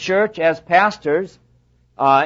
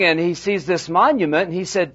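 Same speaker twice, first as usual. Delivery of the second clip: normal talking voice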